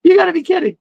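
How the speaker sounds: high, disbelieving squeak; can't believe what you're hearing; incredulous. Retold or imitated. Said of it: "And I was like".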